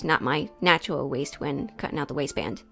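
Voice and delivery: ordinary talk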